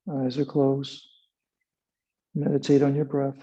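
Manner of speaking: calm instructor calling poses; guided yoga cues. Acted. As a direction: guide the class through the hold. Leads yoga and meditation.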